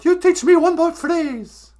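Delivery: high, loud mock demand; hammed-up high-pitched insistence; Greta Thunberg. Activist demands another phrase from host, mock high and loud. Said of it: "All right, Greta".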